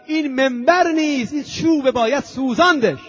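fiery sermon outburst; preaching at full force. Acted. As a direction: thunder it from the pulpit.

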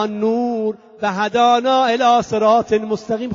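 slow, drawn-out sermon chant; solemn Arabic recitation. Retold or imitated. Imam opening his sermon to his companions, slow solemn Arabic recitation. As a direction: intone each phrase at half speed.

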